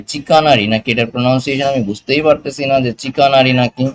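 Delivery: ordinary delivery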